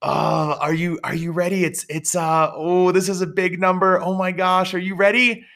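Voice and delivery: high-pitched, jittery, fretting over the total; nervous emotional clerk. Retold or imitated. DMV clerk Amanda frets before revealing the fee, imitated high and nervous. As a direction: mimic a flustered clerk dreading the big number. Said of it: "she got all emotional. ... she went like this".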